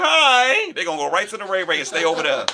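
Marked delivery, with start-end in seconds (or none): 0.0-0.7 very high, loud flirty hi.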